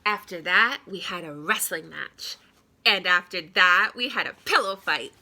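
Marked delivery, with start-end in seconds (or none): none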